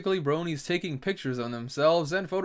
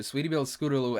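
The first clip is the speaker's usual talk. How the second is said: ordinary talk, nothing marked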